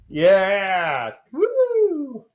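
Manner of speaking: whooping loud and high; party yell at a keg stand; frat bro cheer. Pretend. Cheering the chug.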